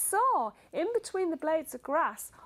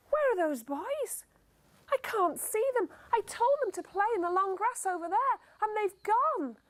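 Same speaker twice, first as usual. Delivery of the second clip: higher, anxious mother searching; worried mum; 8 semitones higher than usual. Acted. Mother looking for her lost boys, worried.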